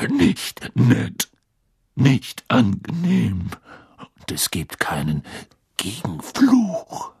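slow growl breaking into whispers; grim Auror teacher. A narrator's reading. Teacher telling pupils of the unblockable curse, slow menacing growl. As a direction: drag out key words, rasping and hushed. Moody lectures after the killing.

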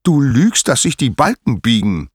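high, loud, angry repeat; outraged shout; exploding accusation. Acted. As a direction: burst out at a shameless liar. Apollo repeats the accusation louder.

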